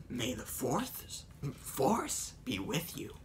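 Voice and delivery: slow, soft, far-off Yoda; a hushed little sage. Retold or imitated. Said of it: "Which is Yoda".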